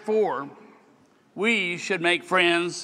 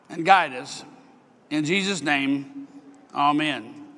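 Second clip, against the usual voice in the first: prayer voice. Acted. A pastor closes the prayer before his address, earnest and unhurried.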